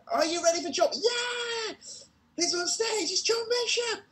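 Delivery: very high, shouted showbiz intro; hyped announcer intro. Retold or imitated. Warm-up man introducing John to the crowd, very high and shouted. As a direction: bring the star on like a game-show host.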